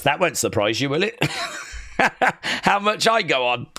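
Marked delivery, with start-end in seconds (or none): 1.2-2.6 breathy self-amused laugh. Real, not acted. Laughs at himself for talking so much, amused breathy laugh.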